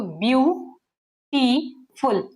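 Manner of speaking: Beautiful in three slow pieces with long gaps; broken into three syllables. Shown as a demonstration. Teacher counts three breaks for students, beautiful slowly in three separate syllables. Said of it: "तीन बार इसको तोड़ा गया".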